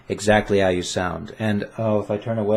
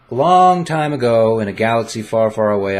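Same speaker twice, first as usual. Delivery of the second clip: epic movie opener; 5 dB louder than usual. Pretend. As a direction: boom it out like the Star Wars opening crawl. Star Wars opening parody.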